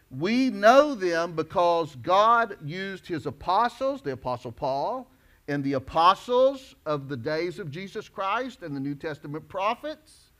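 ordinary talk

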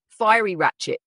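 ordinary delivery

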